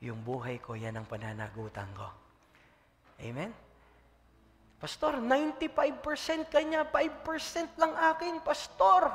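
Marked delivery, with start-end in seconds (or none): 4.8-9.1 much higher, louder pleading complaint; high whining mock complaint to the pastor; whiny church member. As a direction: play the aggrieved member.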